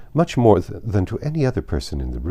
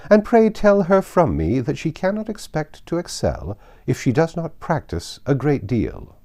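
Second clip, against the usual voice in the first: Lady Catherine; 8 semitones higher than usual. A narrator's reading.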